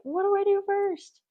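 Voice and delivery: high, loud flustered question; overwhelmed. Retold or imitated. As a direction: squeal it like you can't choose. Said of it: "staring at the box like".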